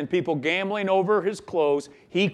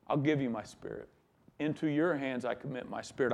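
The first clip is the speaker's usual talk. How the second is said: softer, quicker dying words; hushed surrender; 5 semitones lower, 10 dB quieter, faster than usual. Acted. Jesus on the cross commending his spirit to the Father, hushed. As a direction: let it fall away to a hush.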